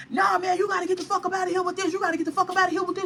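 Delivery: lightly mimicked bouncer, rushing on one note; hurried mock insistence; imitated bouncer. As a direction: voice the man shooing them out. Retells bouncers ejecting her.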